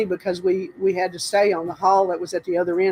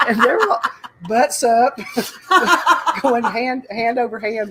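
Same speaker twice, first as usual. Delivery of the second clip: higher, louder, cracking up; real giggles breaking up the words; laughing while talking; 4 semitones higher, 4 dB louder than usual. Real, not acted. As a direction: barely get the story out for laughing. Cracks up telling her funny story.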